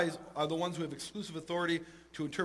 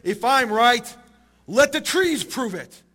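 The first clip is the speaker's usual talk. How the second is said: loud, higher-pitched declaration, drawn out at the end; shouting out; 5 semitones higher, 8 dB louder, slower than usual. Retold or imitated. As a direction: call out a dramatic challenge to the sky. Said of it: "Rabbi Eliezer shouts out".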